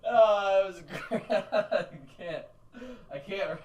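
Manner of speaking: high, loud laughing; breaking up with laughter. Real, not acted. Laughing fit.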